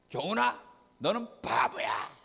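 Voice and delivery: taunting, dragged-out insult; hurtful name-calling. Acted. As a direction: call a child a fool, stretching the words. Demo of hurtful words to child. (놀리듯 상처 주는 말투)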